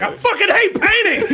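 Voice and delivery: high, loud laughing outburst; mock yell. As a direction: blurt out the rant half laughing. Calling out a rant while laughing.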